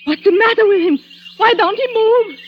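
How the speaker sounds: high, frightened questions; alarmed. Acted. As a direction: panic rising in the voice. Panicked over fallen man.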